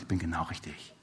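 scared man suddenly at peace in the room, hushed; near-whispered. Retold or imitated. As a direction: breathe it out as a private realization. Sudden calm certainty in prayer.

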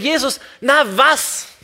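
high, drawn-out exasperated question; mock incredulity. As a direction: play baffled at an obvious question. Preacher mock-questions Jesus' obvious question.

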